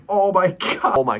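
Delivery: sharp high cry of sudden pain; pain outburst. Real, not acted. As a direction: blurt it out as the burn hits. Sudden burning pain in eyes.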